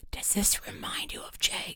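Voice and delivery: teasing whisper to a friend; hushed, breath-only aside. A narrator's reading. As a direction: whisper it from a hiding place. Hidden friend whispers a joke.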